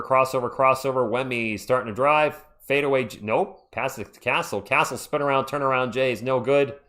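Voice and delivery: usual speaking voice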